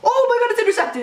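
high, sudden burst of disbelief; shocked cry. Real, not acted. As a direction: blurt it out in real shock. Shock at an interception.

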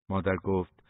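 ordinary delivery

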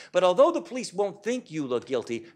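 ordinary delivery

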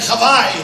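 Hawaii with a v, "ha-VAI"; Greek pronunciation. Shown as a demonstration. Greek dad showing how they say Hawaii, Greek pronunciation. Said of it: "That's how we say Hawaii".

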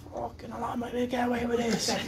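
lightly voiced worried kid; mock Gibbo. Mimicking the hurt friend, worried. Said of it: "he says".

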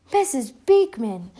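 startled, slow and loud; surprised gasp. Acted. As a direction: caught off guard, drawn out. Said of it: "gasped Teacher Sue".

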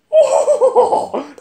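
high, loud evil clown cackle; creepy clown laugh. Acted. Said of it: "next one is Pennywise".

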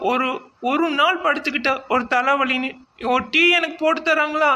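high, loud whiny wailing, put on to win sympathy; fake crying complaint. Wife fakes tears to her husband, high loud wailing. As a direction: sob out the complaint like a wronged wife. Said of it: "எதுக்கு இப்படி அழுகுற?".

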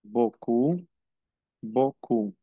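beaucoup said boku, final p silent; silent ending. Shown as a demonstration. Teacher showing silent endings: beaucoup as boku. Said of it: "no pronuncio la terminación".

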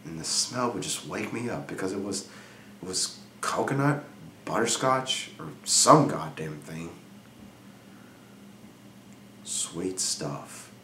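hushed, low, brooding delivery with long pauses; brooding confession. Acted. Jealous husband confiding in Frankie about his wife, hushed and brooding. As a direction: confide it softly and low, letting pauses hang. Brooding opening of dramatic monologue.

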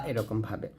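ordinary talk, nothing marked

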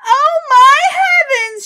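high, loud, drawn-out storybook shriek; mother's high, wailing exclamation; shocked cry. Acted. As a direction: cry out in horror, stretching the words. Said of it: "she cried".

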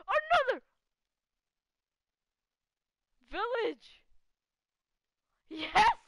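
high excited exclaim on finding something. Real, not acted. Player spotting another village, excited exclaim. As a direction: burst out with delight.

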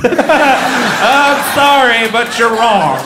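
loud, high, drawn-out I'm sorry; hammed-up loud insistence; mock shouting correction. Comic telling a Wiggles-fan mom she's wrong, mock shout.

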